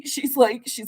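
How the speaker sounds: ordinary delivery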